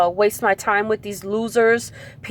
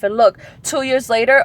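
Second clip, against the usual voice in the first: ordinary delivery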